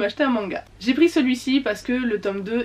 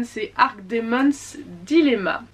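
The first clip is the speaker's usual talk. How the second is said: normal talking voice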